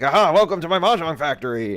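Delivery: lightly voiced whimsical showman; a hint of Gene Wilder's Wonka welcome; playful candy-maker greeting; Willy Wonka impression. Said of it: "Gene Wilder Charlie and the Chocolate Factory thing".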